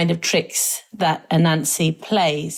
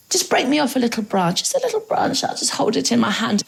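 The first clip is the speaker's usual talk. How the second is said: small, higher wheedling voice; little coaxing voice; 5 semitones higher than usual. A narrator's reading. Anansi asking Tiger for a branch, in a little coaxing voice. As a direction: coax in a light high voice. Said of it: "Anansi again in Tiger's ear".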